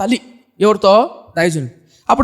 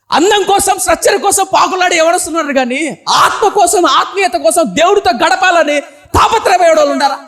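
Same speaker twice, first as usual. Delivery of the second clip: high, raised exhortation building to a shout; full-force preaching; 6 semitones higher, 3 dB louder than usual. Real, not acted. Preacher challenging youth about seeking God, impassioned. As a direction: challenge the congregation, voice pushed up and held there.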